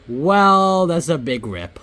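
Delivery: loud, high, drawn-out exclamation; startled reaction. Real, not acted. Gamer reacting to a big loss, startled. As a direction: stretch it out in loud surprise.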